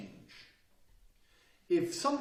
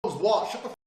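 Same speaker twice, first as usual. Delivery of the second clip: loud, higher snap back; shouting back in an argument; 6 semitones higher, 9 dB louder, slower than usual. Retold or imitated. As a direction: yell back in a heated argument. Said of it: "It's like".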